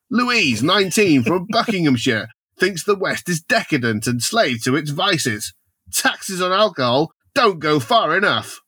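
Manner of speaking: lively, swooping tabloid narration; theatrical story narration. Acted. Presenting Carver's tabloid plan to co-hosts, theatrical narration. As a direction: read it out with showman's relish.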